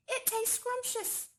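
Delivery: ordinary delivery, nothing marked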